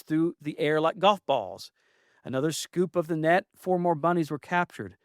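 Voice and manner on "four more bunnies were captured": ordinary delivery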